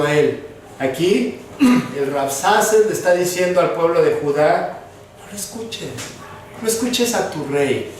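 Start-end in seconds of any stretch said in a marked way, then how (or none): 5.2-5.9 very quiet, almost under the breath; softly planted enemy message; hushed insinuation. Acted. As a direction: drop right down to a hush, as the enemy.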